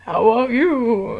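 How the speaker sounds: slow lightly voiced monster talking to a little girl; goofy monster voice. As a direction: stretch it out like a playful monster. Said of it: "I'd be like".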